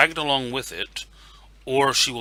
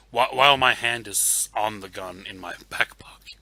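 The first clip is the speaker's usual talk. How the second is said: ordinary talk, nothing marked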